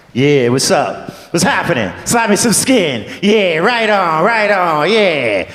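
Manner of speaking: drawn-out, old-TV jive impression; dated jive talk. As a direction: lay on a hammy, out-of-date jive act, stretching the words.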